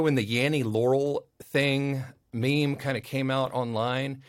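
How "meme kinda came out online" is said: usual speaking voice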